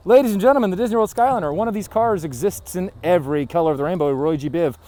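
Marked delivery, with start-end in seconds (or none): 0.1-3.7 announcer voice. Acted. Grand announcement to camera.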